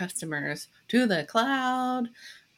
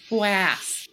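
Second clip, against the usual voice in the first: Queens accent fwas. Shown as a demonstration. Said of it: "with your Queen's accent".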